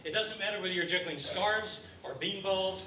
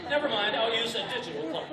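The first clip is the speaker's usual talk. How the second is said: louder punchline; 5 semitones higher, 6 dB louder than usual. Acted.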